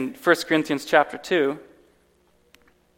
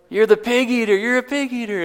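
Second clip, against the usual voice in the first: put-on higher, sing-song jeering teen; mocking taunt; 7 semitones higher than usual. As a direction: taunt him like a cocky teenager. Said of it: "And they're like".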